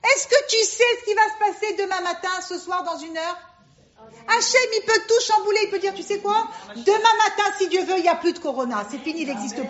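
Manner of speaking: high, insistent preaching; impassioned exhortation. Real, not acted. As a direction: challenge the doubter with rising fervour. Passionately arguing against listener's doubt.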